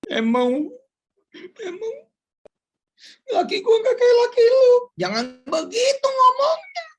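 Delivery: wife crying at her husband; crying nagging wife. Retold or imitated. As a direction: sob out the comparison like a hurt wife. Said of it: "Jangan begini ngomongnya".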